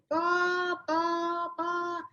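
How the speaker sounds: high mock bah bah babble; Charlie Brown adult voice. Husband's ranting fading to noise, cartoon bah babble. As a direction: drone like a muffled grown-up in a cartoon. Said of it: "his voice sounded like the Charlie Brown voice".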